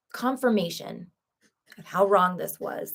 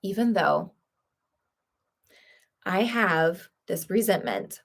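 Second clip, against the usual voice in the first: tapping affirmation. Acted. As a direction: recite each setup line calmly and deliberately. EFT tapping setup script.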